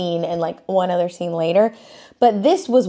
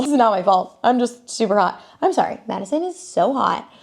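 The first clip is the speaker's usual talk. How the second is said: ordinary talk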